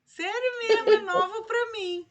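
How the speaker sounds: bem mais agudo e alto, espantada; surpresa. Real, not acted. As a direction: reaja admirada. Professora surpresa com canção. (surpresa ao ouvir canção nova)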